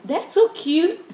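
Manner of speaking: put-on high, slow, gushing girly voice; drawn-out high-pitched squeal of delight. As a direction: coo over it like a super girly mom. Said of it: "they're super girly".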